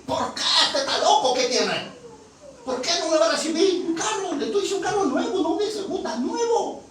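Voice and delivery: high, loud acted outrage; shrieky, hammed-up woman's indignation; indignant disbelief. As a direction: protest as if he has gone mad. Acts out woman outraged at refusal.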